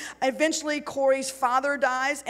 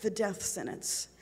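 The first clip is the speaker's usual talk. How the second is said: much softer, nearly gone voice; barely audible; 3 semitones lower, 7 dB quieter than usual. A narrator's reading. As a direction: let it fade to almost nothing. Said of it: "Her answer was barely audible".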